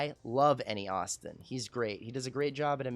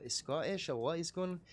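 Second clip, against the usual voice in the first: put-on Scottish accent; 4 semitones higher, 3 dB quieter than usual. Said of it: "Scottish was a little decent there".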